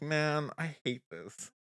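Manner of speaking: slow, soft cringing aside; embarrassed mutter. Retold or imitated. Mom mortified by her son, slow soft mutter. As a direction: groan it under your breath. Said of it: "I was like".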